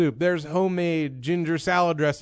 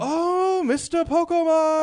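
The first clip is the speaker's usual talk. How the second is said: very high, singing-out waitress greeting; Masako; 13 semitones higher than usual. Retold or imitated. Waitress greeting her regular customer, high imitated sing-out. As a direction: imitate a delighted waitress, pitched way up. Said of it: "pronounced by my waitress Masako as Pokemon".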